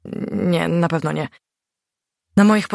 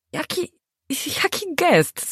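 very high, hesitant asking; a wobbly, uncertain query; unsure question; 12 semitones higher, slower than usual. A narrator's reading. The husband asks Gośka about his gesture, unsure. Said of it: "spytał niepewnie".

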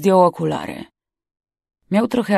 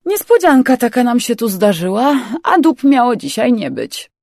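higher, louder jeer at an intruder; amused jeer; 6 semitones higher, 6 dB louder than usual. Acted. Young crewman to the stowaway who turned up, amused jeer. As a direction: jeer loudly at the unexpected guest.

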